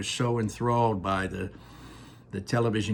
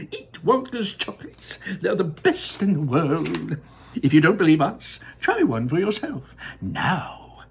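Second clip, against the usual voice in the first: TV commercial voice; 8 semitones higher, 6 dB louder than usual. Acted. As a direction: sell it like an ad. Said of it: "a voice will say".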